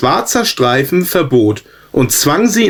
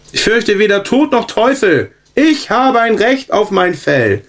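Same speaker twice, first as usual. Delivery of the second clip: higher, firm and unshakeable; insistent defiance; 9 semitones higher than usual. A narrator's reading. Zebra defies everyone who urges it to yield, insistent and raised. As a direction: plant your feet and insist. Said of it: "beharrte es auf seinen Standpunkt".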